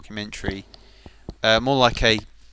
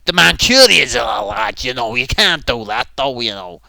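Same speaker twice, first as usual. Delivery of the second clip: loud, hammed-up Mancunian; shaky stab at a Mancunian accent, punched out loudly; 10 dB louder than usual. Showing how Mancunians differ from Scousers, loud mock Mancunian. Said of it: "Mancunian's very different".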